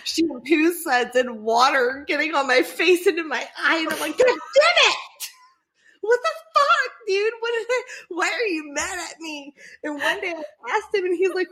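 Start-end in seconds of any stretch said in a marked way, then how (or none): 4.2-9.5 high-pitched, hammed-up angry complaint; exasperated outburst. Partner retelling shower fight to friend, mock-furious. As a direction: cry out in mock outrage, easing down by the end.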